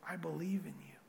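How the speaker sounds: whispered, no voice. Shown as a demonstration. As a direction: breathe it as a whisper. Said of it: "repeated as whisper".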